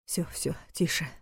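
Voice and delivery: whispered soothing hush; calm her with a soft murmur. A narrator's reading. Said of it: "пробормотал тот".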